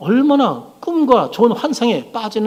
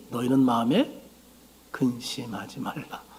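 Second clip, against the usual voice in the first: low, soft, slowing words of comfort; solemn, quiet reassurance in a lowered voice; gentle reassurance; 5 semitones lower, 9 dB quieter, slower than usual. Retold or imitated. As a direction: quote Jesus calming his disciples, hushed and slow. Jesus reassuring his anxious disciples.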